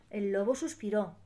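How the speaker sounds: ordinary delivery, nothing marked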